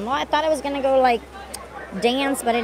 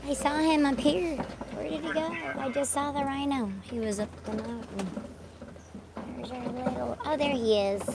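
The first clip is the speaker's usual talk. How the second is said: whisper-eager spotting the rhino; hushed; 3 semitones higher, 12 dB quieter than usual. Real, not acted.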